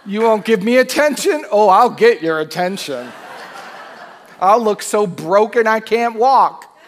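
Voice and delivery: loud mock sulk with a laugh; hammed-up pouting son, loud and laughing. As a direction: play the pouting brother for laughs. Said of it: "That's what the good son did".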